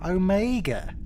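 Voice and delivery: put-on New Zealand accent. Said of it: "with a thick New Zealand accent".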